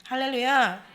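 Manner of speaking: loud falling shout of 할렐루야; loud hallelujah. Acted. Preacher calling hallelujah to the congregation, loud. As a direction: ring it out to the congregation.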